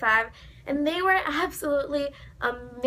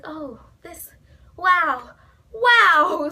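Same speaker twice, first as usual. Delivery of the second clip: building amazement; 4 semitones higher, 7 dB louder, much slower than usual. Retold or imitated. Overwhelmed after the picture.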